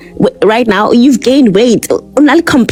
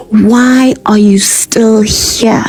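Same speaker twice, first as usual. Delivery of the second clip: taunting whisper; 2 semitones higher, much slower than usual. Real, not acted. Rhetorical taunt at critics.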